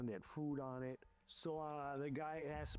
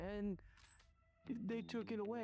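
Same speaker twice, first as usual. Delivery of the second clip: imitating a panicking maid; 8 semitones higher than usual. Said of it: "she started panicking".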